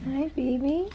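high soft slow coo. Real, not acted. Mother coos at baby.

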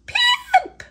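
very high squeaked call of Pip!. Acted. Squeak calling to Pip in a very high alarmed squeak. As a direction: squeak his name in alarm. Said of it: "squeaked Squeak".

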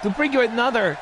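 ring announcer. Pretend. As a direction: open the show to the crowd. Host opens show like announcer.

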